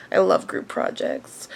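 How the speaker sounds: low, soft dry aside; deadpan sarcasm. Real, not acted. Student aside on group project, low deadpan sarcasm. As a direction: drop pitch and volume, unimpressed.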